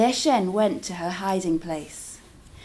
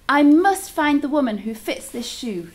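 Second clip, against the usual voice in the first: louder, higher royal declaration; king declaring; 5 semitones higher, 6 dB louder than usual. A narrator's reading. King declares to his court, loud and firm. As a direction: proclaim it firmly. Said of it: "the king declared".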